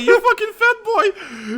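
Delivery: high, slow, laughing punchline; laughing mock quote. Real, not acted. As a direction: stretch it out while laughing. Friend mocking the quote, laughing.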